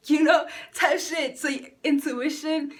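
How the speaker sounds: laughing loudly. Real, not acted. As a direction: laugh through it.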